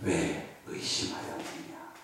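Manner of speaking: soft, low, slow and hushed; a quiet, gentle rebuke from Jesus; gentle question. Retold or imitated. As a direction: ask it tenderly, almost breathed. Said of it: "그 다음에 말씀하셨어요".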